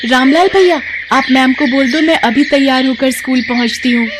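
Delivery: put-on high, bright girlish eagerness; eager young girl. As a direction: jump at the chance to race. Palak eager to go.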